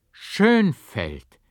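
a sudden astonished call; astonished cry. A narrator's reading. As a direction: shout the name in disbelief. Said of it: "rief ich voll Verwunderung".

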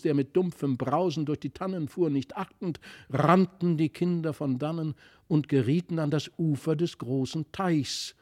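ordinary delivery, nothing marked

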